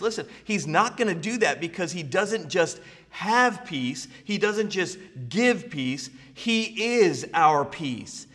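rising, loud preacher's build on peace; preaching at full force. Acted. Preacher swelling to a peak over the congregation.

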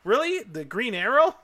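high, loud burst of surprise; can't believe the answer; amazed disbelief. Real, not acted.

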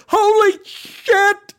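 very high, loud, drawn-out scream; full-blown shriek of amazement. A narrator's reading. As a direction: scream it out in shock. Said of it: "I screamed".

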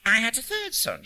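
put-on high-pitched North Country old lady; storytelling in a North Country accent, leaping up high. A narrator's reading. Old lady telling a stranger about her sons, North Country accent. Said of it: "in an English North Country accent".

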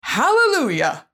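high, slow, drawn-out hallelujah; joyful relieved hallelujah; triumphant hallelujah. Real, not acted. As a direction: sing it out like a victory. Celebrating the success.

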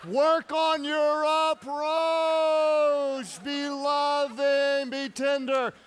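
slow, loud, high preaching; emphatic preaching. Acted. Preacher urging husbands, slow loud shout. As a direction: drive it home from the pulpit. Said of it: "preaching at full force".

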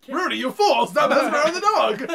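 laughing mock scolding at the screen; mock anger. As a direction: scold the movie character in jest. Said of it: "You get so mad at Rooney".

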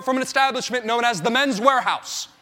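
normal talking voice

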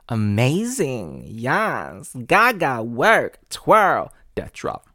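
loud, sassy ballroom hype; over-the-top drag queen cheering. Retold or imitated. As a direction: hype them up like a drag crowd. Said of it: "like to tell you things like".

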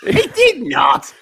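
high, drawn-out cry of disbelief; incredulous shout. Real, not acted. Disbelief at the retold story.

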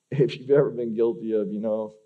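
ordinary talk

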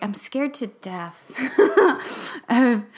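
laughing through the words; self-conscious chuckle; nervous laugh. Real, not acted. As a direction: let it break into a real laugh. Laughing admission of fear.

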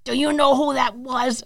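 put-on a light New York accent on the question; lightly voiced New York woman asking; a hint of a New York lady. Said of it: "like, New York accent".

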